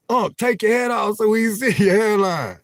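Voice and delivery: playful hammed-up heckler shout; mock heckle. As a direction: yell it like a rowdy commenter. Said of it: "They used to check".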